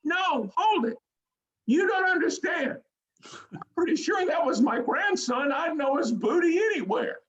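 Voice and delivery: put-on high old grandma voice, proud; squeaky grandmother claiming her grandson; old lady caller. Caller explains the naked player, high proud grandma voice. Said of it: "she stops me and she goes".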